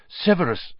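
pleading calm friend; Lupin voice. A narrator's reading.

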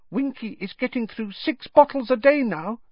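very high, small elf voice; high-pitched mock whisper, fully voiced; confiding elf. A narrator's reading. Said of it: "Dobby whispered to Harry".

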